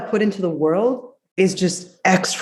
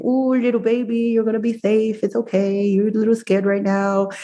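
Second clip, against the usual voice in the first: put-on high, tender baby-talk comfort; sweet voice to your inner child; 4 semitones higher than usual. Shown as a demonstration. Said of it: "talk to yourself in this very sweet way".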